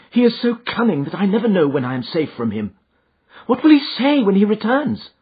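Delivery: high, anxious lady's voice; Miss Stoner, fearful. A narrator's reading. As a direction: voice her dread to the detective. Miss Stoner fears her stepfather.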